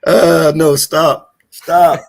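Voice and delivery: loud, laughing no, stop it; loud protest. Real, not acted. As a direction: shout him down, half joking. Stopping a friend's joke reading.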